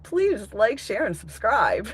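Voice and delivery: high, loud hammed-up sign-off; loud YouTuber outro. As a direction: belt it like a cheesy host.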